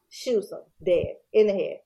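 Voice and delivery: ordinary talk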